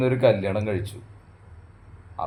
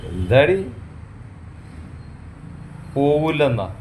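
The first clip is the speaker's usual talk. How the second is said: angry outburst; 6 semitones higher than usual. Acted. Husband snapping at his wife who refuses to go, angry. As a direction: snap at her in disbelief.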